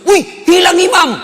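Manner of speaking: imitating a startled yell, very high and loud; retold shout. Friend discovering the imam is gone, shouting. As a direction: cry out loud and high, acting the alarm. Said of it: "Langsung teriak".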